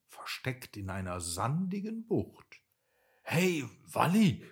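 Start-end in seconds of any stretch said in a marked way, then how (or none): 3.3-4.4 a loud call across the bay. A narrator's reading. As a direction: hail a friend from a distance. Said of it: "rief Lenny". (laut herüberrufen)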